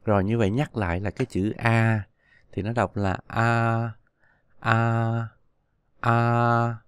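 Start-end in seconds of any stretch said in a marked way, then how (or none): 3.3-6.8 long, level A with the mouth wide open. Shown as a demonstration. Teacher models the vowel A for learners, open-mouthed and drawn out. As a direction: hold A out steadily, mouth opened wide. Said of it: "há miệng to ra, đọc là A".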